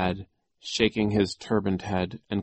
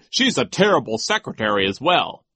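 pompous, raised telling-off; scornful vice principal; 5 semitones higher, 8 dB louder than usual. Acted. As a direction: scold the orphans with contempt. Voices Nero.

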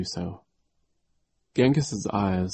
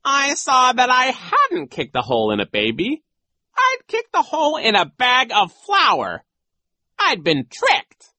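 high, loud, theatrical villain; dramatic storytelling in a raised voice; gloating villain coach; 9 dB louder than usual. Acted. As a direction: relish the nasty tale. Voices Genghis.

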